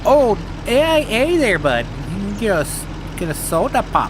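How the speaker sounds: cheery Minnesota accent impression. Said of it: "the total Minnesota accent".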